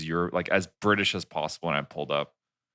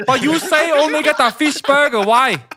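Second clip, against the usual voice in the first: very high, shouted mock rant; irate prank customer; 9 dB louder than usual. Prank customer demanding a burger, high shouting. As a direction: shout high in a put-on accent, outraged.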